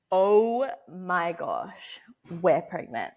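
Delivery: slow, hushed stunned awe; stunned realisation. Retold or imitated. Wife discovering the pregnancy test result, slow stunned awe. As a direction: let it dawn on you slowly.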